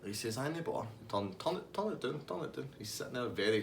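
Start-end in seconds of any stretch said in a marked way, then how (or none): 0.4-2.7 chilled out. Retold or imitated. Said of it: "he's totally fucking chilled out".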